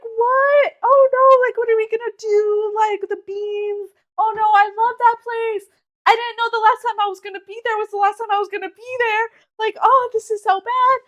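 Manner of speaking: high, distressed, rising panic; panicked dismay. Retold or imitated. To mom, reliving the panic that the place closed, high distressed dismay. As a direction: replay the shock of losing a favourite place. Retelling panicked reaction to mom's news.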